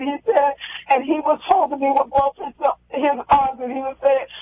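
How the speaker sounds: usual speaking voice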